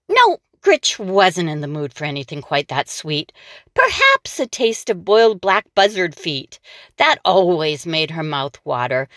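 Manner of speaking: ordinary delivery, nothing marked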